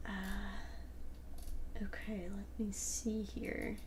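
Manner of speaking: soft unvoiced aside to herself; whispering. Real, not acted. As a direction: whisper it under your breath. Reading chat to herself.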